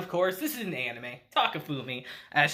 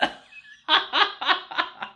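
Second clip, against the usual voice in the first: high, long burst of laughter; real high cackling; big cackle; 11 semitones higher than usual. Real, not acted. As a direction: crack up helplessly. Cracking up at the scene.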